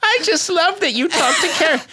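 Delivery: put-on high, quavery old woman; old lady listener. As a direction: squeak it out like a tiny old fan. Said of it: "she's a hundred and ten years old".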